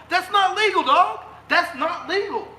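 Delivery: really fired up, hammering it home; angry outburst. Real, not acted. Ranting to viewers about fines, angry. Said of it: "I'm getting a little angry".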